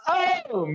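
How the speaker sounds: shrieking laugh, real; high laughing fit. Real, not acted. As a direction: laugh until it cracks high. Cohost laughing hard.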